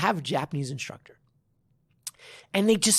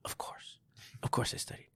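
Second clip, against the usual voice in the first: smug mock whisper; 12 dB quieter than usual. Mocking smug new students.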